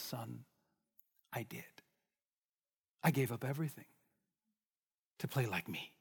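put-on old violinist quietly answering the eager youth; old master's quiet reply. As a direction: let it fall gently, almost to nothing.

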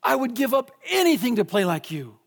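put-on young man near tears, voice lifted; young violinist begging the master, choked up; pleading young man. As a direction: beg him with all your longing. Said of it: "comes up to him afterwards in tears".

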